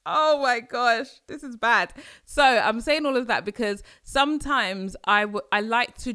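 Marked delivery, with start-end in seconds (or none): 0.0-1.1 louder laughing "oh my gosh"; embarrassed laughing outburst; laughing exclaim. Real, not acted. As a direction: crack up at yourself. Laughing at herself.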